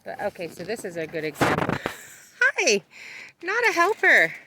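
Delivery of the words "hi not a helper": high, loud bright hello; excited greeting. Real, not acted. Greeting animal, teasing.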